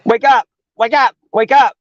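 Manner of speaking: high, loud wake up, wake up; urgent calling. Retold or imitated. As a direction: shake him awake, yelling. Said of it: "starts shaking him".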